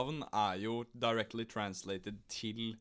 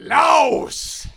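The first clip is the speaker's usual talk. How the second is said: a big confident shout of the answer; triumphant shout; 15 dB louder than usual. Real, not acted. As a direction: yell the answer high and loud, sure you've won. Blurts answer after countdown.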